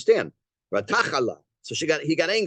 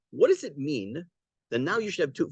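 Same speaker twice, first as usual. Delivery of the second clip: ordinary talk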